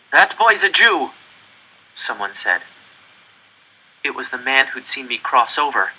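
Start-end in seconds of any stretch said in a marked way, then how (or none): 0.1-1.1 put-on higher, louder, slow accusation, stress on boy's; accusing man. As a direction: point him out to the guards. Pole denounces the boy.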